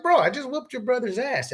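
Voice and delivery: high, exasperated comeback; incredulous retort. Retold or imitated. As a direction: throw it back at him, amazed.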